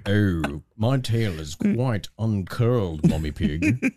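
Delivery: a low lovesick cartoon pig purr; mock deep Daddy Pig. Cohost voicing Daddy Pig in bed, low mock purr. Acting Peppa Pig bedroom scene.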